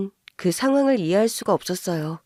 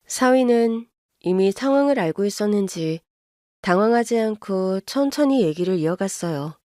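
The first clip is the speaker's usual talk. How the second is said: plain reading voice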